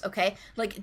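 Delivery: ordinary talk, nothing marked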